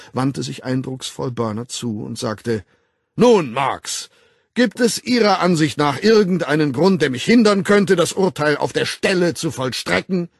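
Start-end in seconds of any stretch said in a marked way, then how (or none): 3.1-10.3 loud, high, formal public denunciation; a director condemning an employee before the staff; stern director's speech. Acted. Director denouncing Bernard to the assembled staff, loud and stern.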